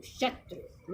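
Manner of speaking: शत्रु whispered with short उ; whispered correct take. Shown as a demonstration. Teacher contrasting the right शत्रु for pupils, whispered. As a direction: breathe शत्रु softly, short उ. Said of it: "शत्रु होता है।".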